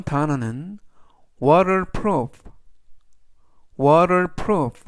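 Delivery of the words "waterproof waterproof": "waterproof" said slowly and clearly, a little louder; pronunciation demo. Shown as a demonstration. Teacher modelling "waterproof" for students, slow and clear. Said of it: "waterproof라고 발음하시면 되겠고요".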